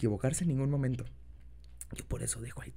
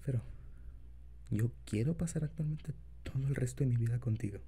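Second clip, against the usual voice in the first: put-on soft, low boyfriend voice; tender, shy lover, quiet; incubus; 5 semitones lower, 6 dB quieter than usual. Continues confession to partner.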